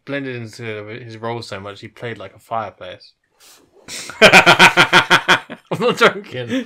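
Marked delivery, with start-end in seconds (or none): 3.9-6.6 laughing. Real, not acted. Laughing at his own claim.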